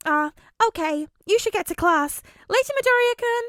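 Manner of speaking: high, loud bubbly goodbye; cheerful girl voice. A narrator's reading. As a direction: sing out a bright goodbye. Nejire bids farewell.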